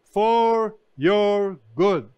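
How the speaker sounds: preacher leans hard on "for your good"; emphasis on for your good. Shown as a demonstration. Preacher stressing to congregation: "for your good" slow and loud. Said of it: "The emphasis".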